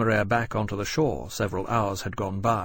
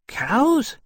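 very high, loud squeal of a question; excited wonder; 14 semitones higher, 5 dB louder than usual. A narrator's reading. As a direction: pipe up in delighted amazement. Little dragon amazed at food offer.

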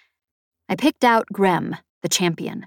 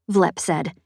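ordinary delivery

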